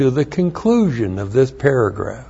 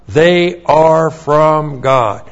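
slow emphatic; much slower than usual. Acted. Preacher repeats key line.

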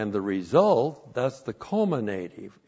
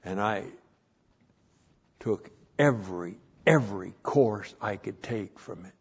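usual speaking voice

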